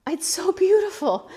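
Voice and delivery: teary. Real, not acted. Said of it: "now I'm gonna cry again".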